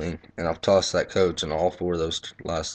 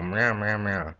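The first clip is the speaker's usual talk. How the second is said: mock whiny meows aping the nagging coach; sarcastic cat noises mimicking a complaint; 3 semitones higher, slower than usual. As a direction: meow him down like a nagging cat.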